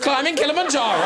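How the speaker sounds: high, loud incredulous echo with a laugh; amused disbelief. Real, not acted. Host echoing a guest's wild claim, laughing disbelief. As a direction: repeat it back as if you can't believe it.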